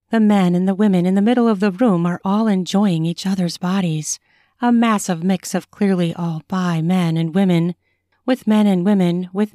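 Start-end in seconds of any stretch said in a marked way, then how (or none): none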